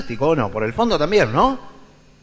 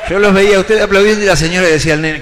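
ordinary talk

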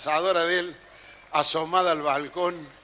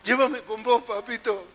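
a bit higher, mock tearful begging; pleading child; 4 semitones higher than usual. Barefoot boy begs the dead man to take him, mock tearful plea. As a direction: beg like a crying kid running beside the hearse. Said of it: "trotaba al lado del coche fúnebre y decía".

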